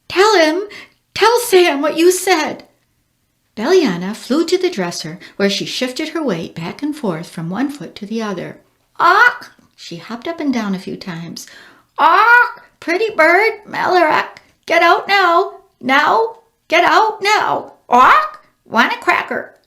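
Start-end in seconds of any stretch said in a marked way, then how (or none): 0.1-2.7 high, loud, slow demand; frantic. A narrator's reading. 9.0-9.5 high loud squawk; parrot squawk. A narrator's reading. 12.0-19.6 high, loud squawking parrot. A narrator's reading. Bird plays dumb parrot.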